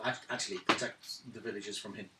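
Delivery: normal talking voice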